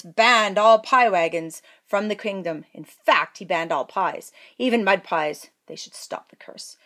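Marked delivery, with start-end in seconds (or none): 5.7-6.8 hushed whisper; king plotting to stop the curse. A narrator's reading. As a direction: whisper it worriedly. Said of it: "said the king".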